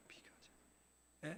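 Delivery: barely audible whisper; fainter whisper. Shown as a demonstration. Preacher repeating the answer in a fading whisper. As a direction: let it fade to a breath.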